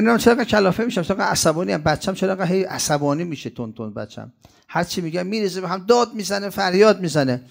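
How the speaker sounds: fast, high, fed-up complaining parent; exasperated mother. Retold or imitated. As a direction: whine it like a worn-out mother. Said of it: "میگه".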